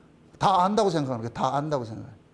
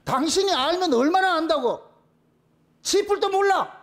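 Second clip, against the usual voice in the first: higher, louder scornful retort; indignant outburst; 6 semitones higher, 3 dB louder than usual. Real, not acted. Pastor scolding a know-it-all colleague, indignant and loud. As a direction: fire back in real indignation.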